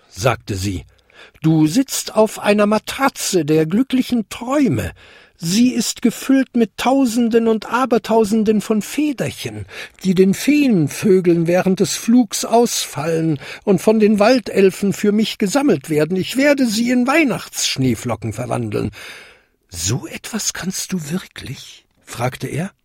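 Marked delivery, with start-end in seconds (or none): 1.4-18.9 put-on high old-lady voice; high-pitched, stately storybook fairy; grand fairy godmother. 19.7-21.8 high, soft wondering question; small soft goblin voice, amazed; awed little goblin. Acted.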